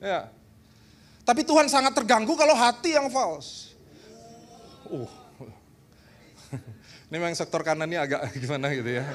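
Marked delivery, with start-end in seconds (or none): none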